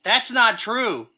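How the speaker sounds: loud slow protest. Real, not acted. Playful objection.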